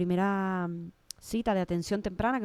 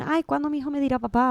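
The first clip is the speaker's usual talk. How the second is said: mock anxious parent; 7 semitones higher, 4 dB louder than usual. Imagined anxious parent's thought.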